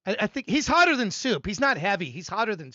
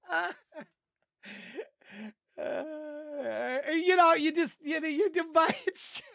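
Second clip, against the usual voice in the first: talking through high-pitched laughter; helpless laughter; 4 semitones higher, 4 dB quieter, slower than usual. Real, not acted. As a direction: try to get words out while cracking up. Cracking up after chewing his shoe.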